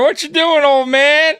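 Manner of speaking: high, loud taunting voice; mocking bison taunt. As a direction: tease the old man from up high. Bison taunting the old man.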